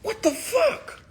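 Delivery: shrieking high disbelief, slowing down; freaking-out yell. Retold or imitated. Confronting the man grabbing him, a climbing high shriek. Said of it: "you spaz even more".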